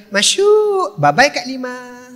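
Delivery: high, slow smug farewell; gloating goodbye. Retold or imitated. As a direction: rub it in as you leave.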